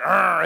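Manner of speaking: mock angry little-boy growl, shouted; toddler growl. Father contrasting his two sons' tantrums, shouted growl. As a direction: snarl it like the older son. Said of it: "instead of like".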